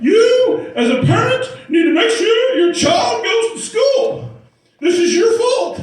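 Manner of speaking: high loud hammed-up lecturing judge; stern judge scolding. Judge scolding the father in court, mock loud lecture. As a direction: bellow it like a judge reading the riot act.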